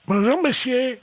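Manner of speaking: put-on high-pitched old lady; squeaky elderly woman on the phone. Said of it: "passer le téléphone à la dame".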